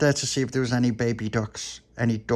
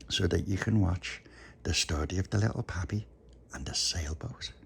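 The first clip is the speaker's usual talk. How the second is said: soft, hushed goodnight voice; bedtime whisper; 4 semitones lower, 8 dB quieter than usual. Real, not acted. Bedtime soft talk to child.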